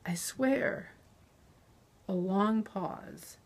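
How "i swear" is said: high, whispery plea; strangled voice. A narrator's reading. Captive swearing truth to interrogator, strangled whisper. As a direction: squeeze it out with barely any voice. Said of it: "I said in a strangled voice".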